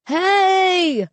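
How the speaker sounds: high, sharp call to get attention; shout. A narrator's reading. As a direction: call out while pointing. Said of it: "Mark shouted".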